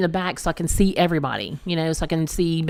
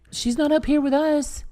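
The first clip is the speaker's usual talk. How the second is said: panicked call; 10 semitones higher than usual. Retold or imitated. Panicked mom calling for lost kid.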